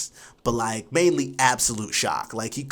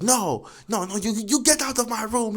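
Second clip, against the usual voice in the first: mock furious yell, pitched up high; retold angry teacher; 11 semitones higher than usual. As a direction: imitate the teacher throwing him out, shouting. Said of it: "He's, like, so mad".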